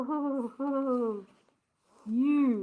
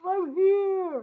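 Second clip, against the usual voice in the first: muffled voice; 7 semitones higher, 5 dB louder than usual. Acted. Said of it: "came Ron's muffled voice".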